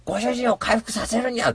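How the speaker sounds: high, cutesy cat sidekick line; cat handing over healing honey, in a high cutesy voice; cat companion voice. Pretend. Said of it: "だよ、本当のセリフは".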